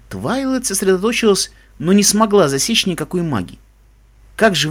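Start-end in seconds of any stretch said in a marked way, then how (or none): none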